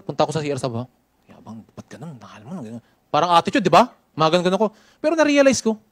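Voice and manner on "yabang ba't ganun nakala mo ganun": much softer resentful grumbling under the breath; sulky grumble to self. Retold or imitated. Hurt trainee sulking alone in restroom, low grumble. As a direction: grumble like a hurt trainee nobody should hear.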